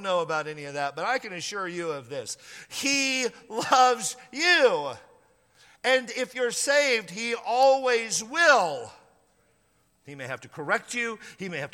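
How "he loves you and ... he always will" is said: very slow, louder and higher, stretched words; slow emphatic assurance. Real, not acted. Pastor to doubting listener, slow loud assurance. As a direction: promise it with full weight.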